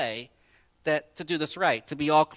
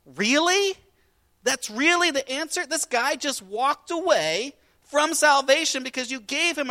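higher, louder startled disbelief; shocked disciples; 11 semitones higher, 7 dB louder than usual. Acted. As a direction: react like stunned followers.

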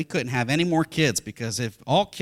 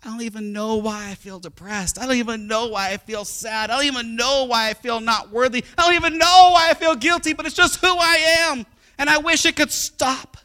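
building from restrained to a high, loud wail; mounting despair; 8 semitones higher, 7 dB louder than usual. Acted. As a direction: escalate each repetition into an anguished, acted outcry. Acts out despairing believer, building.

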